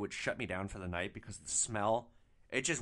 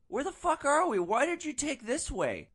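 much higher, louder mock drunk kid; upset drunk passenger; 14 semitones higher, 5 dB louder than usual. Drunk passenger waking up lost in the driver's car, high indignant mock voice. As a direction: whine it in a high, indignant put-on voice.

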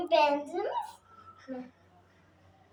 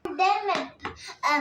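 ordinary talk

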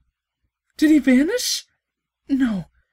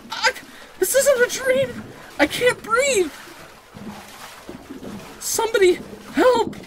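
panicked cry; 11 semitones higher than usual. Acted. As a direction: scream for help as the water closes in. Waking up drowning in panic.

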